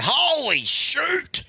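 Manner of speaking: put-on very high, slow, sing-song kid voice; kid's new catchphrase. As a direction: draw out Holy like a proud little boy. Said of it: "he's going".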